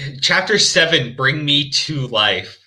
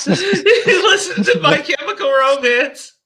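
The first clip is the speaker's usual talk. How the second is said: laughing; 12 semitones higher, 3 dB louder, slower than usual. Real, not acted. Laughing at the autoplay music.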